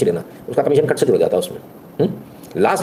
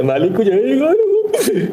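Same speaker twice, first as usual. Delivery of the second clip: high, very slow whining beggar voice; beggar's plea; 11 semitones higher, much slower than usual. Retold or imitated. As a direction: beg pitifully like a street beggar. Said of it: "बिल्कुल भिखारी बन के जाओ".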